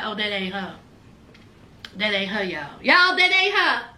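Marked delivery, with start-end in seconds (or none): 0.0-2.8 low, hushed mock aside; quiet, low retold mumble of embarrassment; embarrassed aside. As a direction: drop it right down, as if to friends only. Realizes mistake, tells her friends aside.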